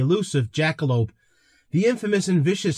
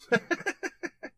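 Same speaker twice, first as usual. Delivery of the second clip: laugh; 14 semitones higher, 7 dB quieter than usual. Real, not acted. Laughs at own separatist joke.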